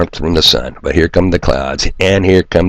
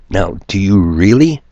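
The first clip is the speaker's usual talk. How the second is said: ordinary delivery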